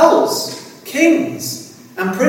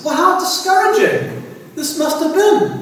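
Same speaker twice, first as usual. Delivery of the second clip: usual speaking voice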